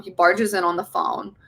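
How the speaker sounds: usual speaking voice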